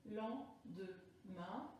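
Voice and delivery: "Lendemain" slow and soft, in three detached syllables; découpage syllabique. Shown as a demonstration. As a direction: quietly recount "Lendemain" syllable by syllable. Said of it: "dans la dernière syllabe". (« Lendemain » doucement, syllabe par syllabe)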